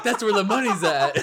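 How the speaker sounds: laughing through the quote; high, loud, laughing quote. Retold or imitated. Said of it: "I'm like".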